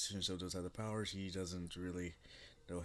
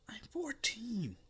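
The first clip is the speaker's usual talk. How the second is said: much higher, whispered defence; defensive boy; 13 semitones higher than usual. Acted. Boy defending himself to the queen, high embarrassed whisper. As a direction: insist on it, embarrassed.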